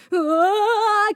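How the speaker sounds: high, loud, slow falling scream. Acted. Vendel slipping on the ice, high loud scream. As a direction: yelp as feet slip away. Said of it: "kiáltott nyomban".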